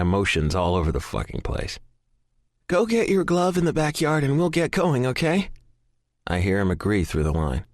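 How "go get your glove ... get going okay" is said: put-on mother to child. A narrator's reading. Mother speaking to her child.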